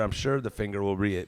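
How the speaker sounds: ordinary talk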